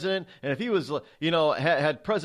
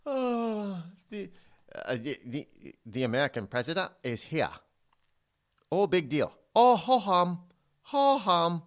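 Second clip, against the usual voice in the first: lightly put-on Chinese accent, bored and dismissive; mock a Chinese official shrugging off a visitor; a hint of a bad Chinese accent, unimpressed. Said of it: "that's my very bad Chinese accent".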